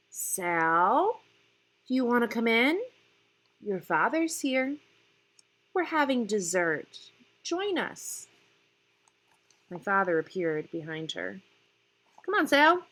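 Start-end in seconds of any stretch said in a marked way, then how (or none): 0.1-8.3 lightly voiced, higher and louder calling; a hint of a crackly woman inviting her in; crackly neighbour woman. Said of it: "the dead leaf, crackly voice of". 12.2-12.9 higher, louder jovial coax; father cajoles Sal to come in, jovial. Acted. Father coaxes daughter.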